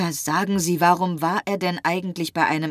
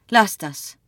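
curt, louder cut-in; interrupting; 5 semitones higher, 7 dB louder than usual. A narrator's reading. Felix cuts off Marie's reassurance, interrupting. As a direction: break in to stop the other speaker. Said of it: "unterbrach sie Felix".

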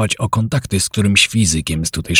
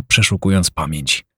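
plain reading voice

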